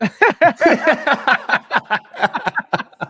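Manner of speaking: loud, high burst of laughter; real laughter. Real, not acted. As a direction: crack up helplessly. Cracks up at typo message.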